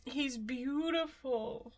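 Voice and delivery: soft, slow, choked with tears; tearful whisper. Real, not acted. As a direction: barely get it out through tears. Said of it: "do I have mascara running down my face".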